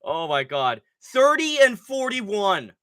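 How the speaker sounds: ordinary talk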